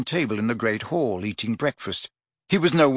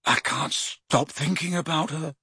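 slow, softer, hoarse confession; softer hoarse lovesick murmur; 3 semitones higher, 5 dB quieter than usual. A narrator's reading. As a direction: rasp it out lovesick. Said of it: "said Ron hoarsely".

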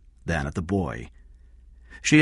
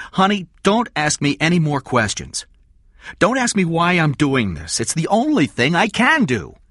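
high, loud, agitated demand; desperate Eddie; 12 semitones higher, 6 dB louder than usual. Acted. Eddie refuses Kathy's questions, desperate outburst. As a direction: push back hard, voice raised.

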